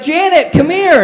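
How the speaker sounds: high, loud mom calling across a crowd; playing mom yelling for her kid in public. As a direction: shout the name up high like a mother in public. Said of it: "she's like".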